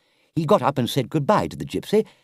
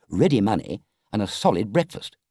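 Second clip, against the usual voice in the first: ordinary delivery, nothing marked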